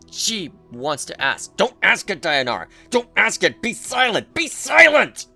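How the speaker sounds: ordinary delivery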